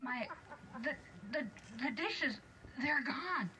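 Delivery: high, amazed dwarf voice; astonished dwarf. Acted. Dwarf finding the dishes gone, high and amazed. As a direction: gasp it out in wonder.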